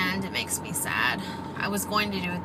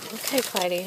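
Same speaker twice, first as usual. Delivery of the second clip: ordinary talk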